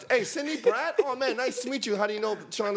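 high, eager party greeting; imitating eager small talk. Imitating himself meeting Sean's friends, high and eager. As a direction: greet strangers with a bright high lift. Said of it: "I was talking to".

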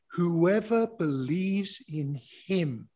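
slow, deliberate proclamation; slow reading. Real, not acted. Preacher reading the promise to believers, slowly and deliberately. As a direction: stretch the phrase out as a solemn declaration. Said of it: "gonna read this really slowly".

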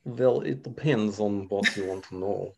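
lightly put-on Russian accent, unhurried. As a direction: play a mock Russian interviewee. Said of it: "give us a, a bit of Russian now".

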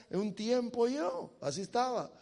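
ordinary talk, nothing marked

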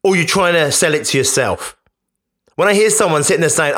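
ordinary delivery

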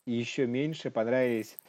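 usual speaking voice

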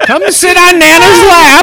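put-on high, slow old lady coo; granny voice. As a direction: coo like a creepy grandma. Voicing granny stripper.

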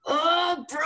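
usual speaking voice

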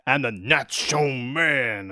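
slow, stretched catchphrase in imitated accent; Scott Hall. Co-host echoing the wrestler's favourite line, imitated accent. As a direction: savour each word in the wrestler's accent. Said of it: "Especially when he goes".

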